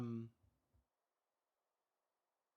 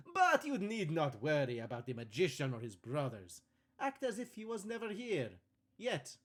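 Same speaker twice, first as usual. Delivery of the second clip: bad Italian accent. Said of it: "faking a bad Italian accent".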